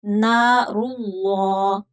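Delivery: नारुल्लाह slow, ending on a dark "llaw"; heavy lam. Shown as a demonstration. Teacher gives an example, full lam in नारुल्लाह. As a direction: stretch नारुल्लाह with a thick l. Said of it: "मोटा होगा".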